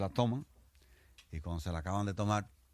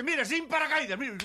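very high, loud yell; defiant shout; 7 dB louder than usual. Acted. Little soldier jumps with no parachute to prove his courage: defiant yell. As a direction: shout it as you jump without a chute.

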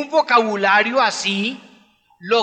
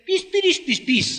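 put-on baby talk; 9 semitones higher than usual. Parent cooing to a baby in their arms, high and tender. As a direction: coo to an infant with pitch jumping way up. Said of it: "hacerle caricias y le dicen".

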